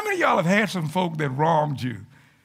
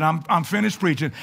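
usual speaking voice

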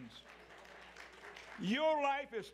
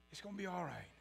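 "It's gonna be all right." much softer and lower, gently; soft reassurance; 4 semitones lower, 6 dB quieter, faster than usual. Shown as a demonstration. Preacher comforting the congregation, soft and low. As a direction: hush it down and comfort them.

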